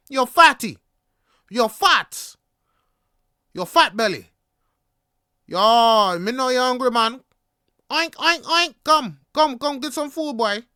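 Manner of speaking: loud, high heckling in imitation; yelled taunts. Vendor going in on hungry passer-by, imitated loud jeering. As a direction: heckle them across the street. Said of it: "And he's yelling".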